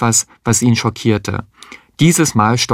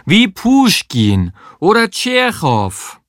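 put-on high, slow, drawn-out Russian accent; heavy Russian accent; 9 semitones higher, slower than usual. A narrator's reading. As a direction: savour the names in exaggerated Russian. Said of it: "so russisch sie konnte". (übertrieben russisch gedehnt)

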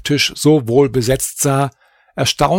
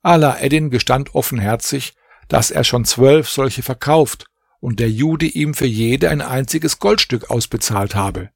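plain reading voice

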